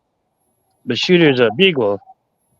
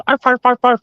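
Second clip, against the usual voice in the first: high-pitched string of quick arfs; imitated dog barking; 12 semitones higher, 3 dB louder than usual. Owner imitating his excited beagle on a walk, high barking. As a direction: bark like an overexcited beagle. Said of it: "he barks a lot".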